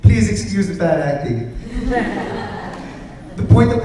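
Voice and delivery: slow, loud, through a laugh. Real, not acted. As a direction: laugh it out, amused. Laughing after the acted skit.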